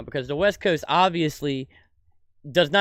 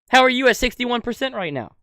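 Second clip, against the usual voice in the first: higher, louder indignant yell; angry shout; 9 semitones higher, 7 dB louder than usual. Real, not acted. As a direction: demand an answer, really fuming. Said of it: "I'm fucking pissed".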